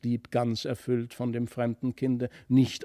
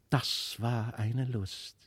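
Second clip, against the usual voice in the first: plain reading voice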